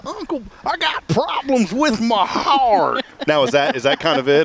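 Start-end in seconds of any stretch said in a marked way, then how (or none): 0.1-3.0 high, slow, exaggerated Oklahoma twang; uncle's twangy drawl. Host imitates late uncle's heart complaint, exaggerated Oklahoma twang. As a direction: stretch it out in a hammed-up Oklahoma drawl. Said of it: "Is that getting the twang in there?".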